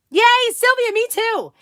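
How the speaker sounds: high, loud squeal of joy; excited cheer. Real, not acted. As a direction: cheer her on delightedly. Cheering a viewer's comment.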